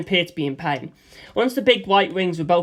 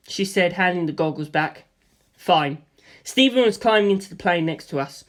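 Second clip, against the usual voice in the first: ordinary delivery, nothing marked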